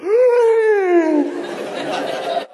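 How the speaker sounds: high, long shocked gasp; collective gasp. Retold or imitated. All of heaven gasps at Jesus's answer. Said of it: "And in one gasp".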